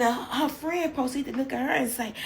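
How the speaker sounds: normal talking voice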